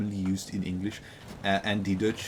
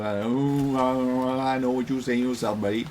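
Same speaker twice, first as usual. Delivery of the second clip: mock Southern accent; 4 semitones higher, 3 dB louder than usual. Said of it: "you're like".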